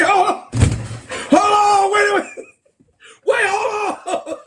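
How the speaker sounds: high, loud panicky voice; mock panic. Streamer mocking the voting officials, high panicked shouting. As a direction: squeal it like a flustered official.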